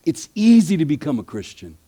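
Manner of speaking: normal talking voice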